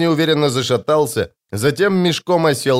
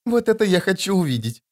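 higher, gleeful character voice; snorted remark; 6 semitones higher than usual. A narrator's reading. Dymka eager to watch the spectacle, snorting. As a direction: snort it with relish. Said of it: "хрюкнула Дымка".

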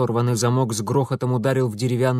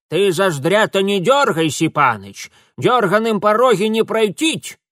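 put-on louder, higher old village woman; businesslike command; 7 semitones higher, 6 dB louder than usual. A narrator's reading. Old woman instructs Mitya in the boat, businesslike command. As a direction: order briskly like a seasoned boatwoman. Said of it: "деловито скомандовала бабка".

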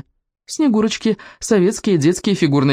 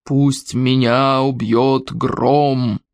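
very slow, drawn-out ominous incantation; menacing and stretched out; slow, sinister; 3 semitones lower, much slower than usual. A narrator's reading. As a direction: intone each word like a dreadful oath. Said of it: "начал говорить медленным, зловещим голосом".

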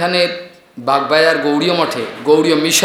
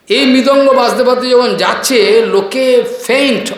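excited retelling; 7 semitones higher, 4 dB louder than usual. Real, not acted. Excited account of drum procession.

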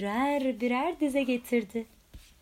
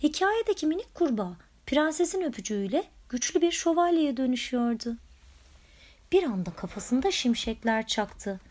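ordinary delivery

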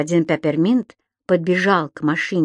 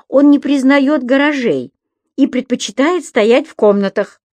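excited; 5 semitones higher, 5 dB louder than usual. A narrator's reading. Subastik laughing.

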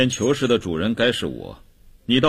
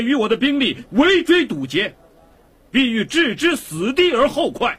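high-pitched, fervent public oration; impassioned denunciation. Acted. Prisoner denouncing his enemies before a crowd, impassioned. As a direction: denounce them at the top of your register.

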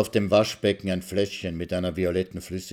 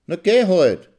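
high, loud brush-off; wife sending husband away; scornful dismissal; 10 semitones higher, 9 dB louder than usual. Acted. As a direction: wave him off scornfully.

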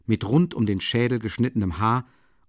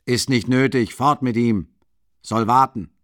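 louder, brusque dismissal; curt order; 6 dB louder than usual. A narrator's reading. Porfiri orders the guards to take Nikolai away, curt. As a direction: wave them off impatiently.

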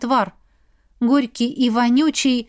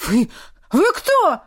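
put-on higher, stammering frightened teen; scared stammer; 6 semitones higher, slower than usual. Teen lifted by his shirt stammers in fright. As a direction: gulp it out, rattled.

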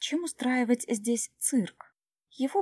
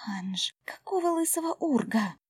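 higher, hissed whisper; hissing whisper; 5 semitones higher than usual. Acted. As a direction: hiss it under breath. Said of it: "зашипела Джу".

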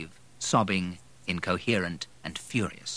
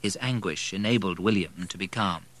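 plain reading voice

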